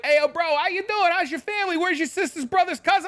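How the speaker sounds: mock over-friendly cashier, pitched very high; chatty cashier. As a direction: imitate a nosy cashier firing off greetings. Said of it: "The cashier's like".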